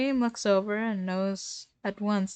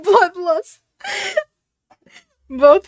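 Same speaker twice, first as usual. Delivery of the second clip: laughing through the word, higher and louder; 11 semitones higher, 15 dB louder, slower than usual. Real, not acted. Reader laughing at Techno's bloodlust. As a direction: crack up on the word.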